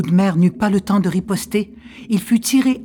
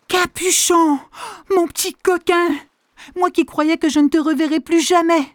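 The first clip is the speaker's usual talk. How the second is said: relieved grandmother; 11 semitones higher than usual. Acted. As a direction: greet the lost fish. (grand-mère soulagée)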